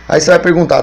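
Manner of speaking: normal talking voice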